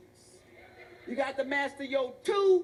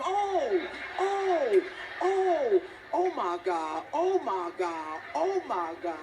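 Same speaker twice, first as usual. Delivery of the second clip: shrieking jackpot winner; much slower than usual. Retold or imitated. Acted pain and pleasure cries.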